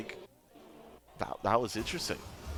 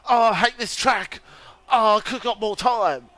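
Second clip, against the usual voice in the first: imitating a whiny frustrated racer, higher and louder; mocking Chris; 10 semitones higher, 6 dB louder than usual. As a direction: lampoon a friend moaning about his lap. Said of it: "usually the party going".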